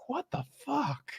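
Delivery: hushed bewildered aside; quoting her reaction. Retold or imitated. Friend retelling a Seinfeld scene, mimicking her baffled reaction. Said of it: "And she's like".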